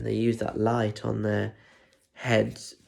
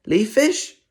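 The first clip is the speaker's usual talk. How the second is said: teacher calling register; 10 semitones higher, 6 dB louder than usual. Acted.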